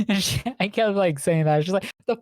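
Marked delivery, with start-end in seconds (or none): 2.1-2.2 high, incredulous "the fuck?"; baffled outburst. Retold or imitated. Girlfriend reacting to Digimon jargon, mock baffled. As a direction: pitch it up in mock disbelief.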